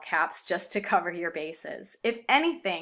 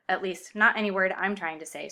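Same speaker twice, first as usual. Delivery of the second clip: ordinary talk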